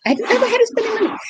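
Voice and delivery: high, quick words through a laugh. Real, not acted. To the host, insisting while laughing.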